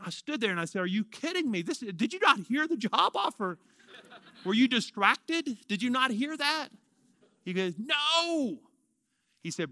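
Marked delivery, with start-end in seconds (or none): 0.8-6.7 high-pitched mock disbelief; incredulous. Stunned man questioning God about the job offer, incredulous. 7.8-8.6 high, drawn-out no; acted-out refusal, pitched way up; insistent refusal. God answering his protest about the job, insistent.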